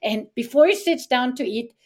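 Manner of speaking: plain reading voice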